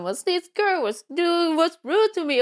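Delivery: high-pitched mock kid's grievance; high, put-on little complainer; imitated complaining child. Imagined child complains to parent.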